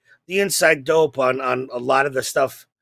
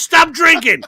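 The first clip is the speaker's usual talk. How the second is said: shouted, much higher and louder; uncle yelling; 11 semitones higher, 6 dB louder, slower than usual. Pretend. Dead uncle shouting at S1 to quit drinking, loud scold. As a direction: bark it like a fed-up uncle. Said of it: "He goes".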